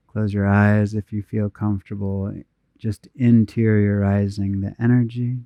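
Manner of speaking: unhurried, even meditation voice with long pauses; guided meditation. Real, not acted. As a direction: guide them gently inward, phrase by phrase, leaving space to breathe.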